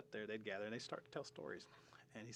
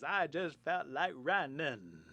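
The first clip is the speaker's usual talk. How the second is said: loud, high, slow drawl; Forrest Gump; 5 semitones higher, 9 dB louder, much slower than usual. Retold or imitated. Line meant for Tom Hanks, drawled impression. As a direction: stretch it out like the movie simpleton. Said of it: "that line where he says".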